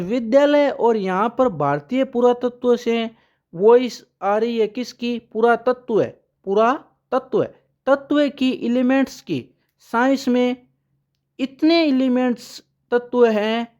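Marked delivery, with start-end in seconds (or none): none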